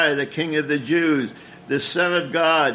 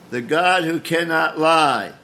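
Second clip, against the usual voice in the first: ordinary talk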